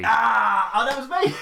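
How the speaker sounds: high, loud sudden realisation; surprised. Real, not acted. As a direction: it hits you that it was you. Recognising his own roll.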